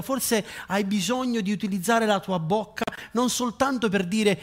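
rising preacher's appeal; fervent preaching. Acted. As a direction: press the congregation with fire. Altar call preaching.

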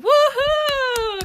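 high, loud whoop; cheering. Real, not acted. Celebrating the co-host's success, high cheer.

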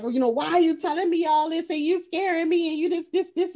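high-pitched hurried protest; mock distress. Host voices the son questioning his mother, mock distress. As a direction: plead high, as if upset. Acts son's frightened protest.

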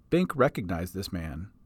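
ordinary delivery

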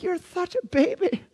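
high, teary teasing voice; mock tearful wife. Wife wiping a tear teases husband for bawling, mock tearful. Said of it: "she'll be over there wiping a tear".

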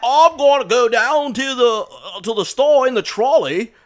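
loud, high put-on British voice; hammed-up Cockney shout; bad British accent. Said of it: "It'll come out like".

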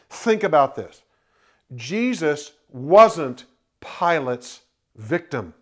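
ordinary talk, nothing marked

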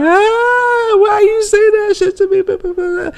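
very high, whiny fake sobbing; hammed-up crying voice; mock crying woman. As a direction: wail it like a hammed-up crying wife. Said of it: "now she crying".

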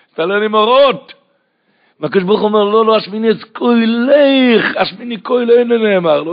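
loud, high-pitched impassioned sermon; preaching at full force. Acted. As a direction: drive the verses home at the top of your voice. (דרשה נלהבת בקול רם)

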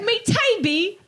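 British accent. Shown as a demonstration. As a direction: put on British vowels with a rising end. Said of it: "that's British accent".

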